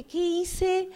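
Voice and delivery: existential crisis. Retold or imitated. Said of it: "crisis existencial en serio".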